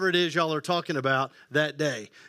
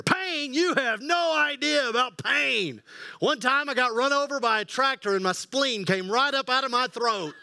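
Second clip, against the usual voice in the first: put-on old man voice; 4 semitones higher than usual. Old guy outdoing a young man's sore shoulder, shouting high. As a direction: shout the tall tale like a proud granddad.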